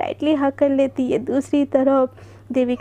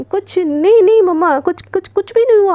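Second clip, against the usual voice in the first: louder, higher, a blurted panicky no; panicked denial; 5 semitones higher, 8 dB louder than usual. Acted. Devika hiding her phone from mom, blurting a loud panicky denial. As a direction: blurt it out to shut the question down.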